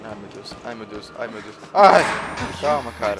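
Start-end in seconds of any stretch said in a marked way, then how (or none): 1.7-2.0 short, loud, sharp cry of fright; startled yelp. Real, not acted. Player jumps at a sudden noise, yelping loud. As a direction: jolt out a yelp at the scare.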